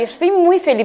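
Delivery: "Estoy muy feliz" said through a very small mouth; tiny mouth. Shown as a demonstration. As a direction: squeeze "Estoy muy feliz" out with lips almost shut. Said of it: "así con la boca muy chiquitita". (con la boca muy chiquitita)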